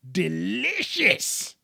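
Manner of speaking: "Delicious" drawn out slowly, pitch swooping up; emphatic relish. Real, not acted. Raving about brother's burgers, "Delicious" stretched out with relish. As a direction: savour "Delicious" with a long, high stretched middle.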